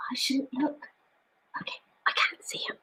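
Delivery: hushed whisper near sleeping cats. Real, not acted. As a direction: whisper so you don't wake them. Whispering not to wake cats.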